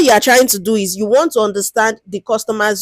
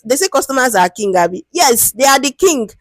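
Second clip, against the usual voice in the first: ordinary talk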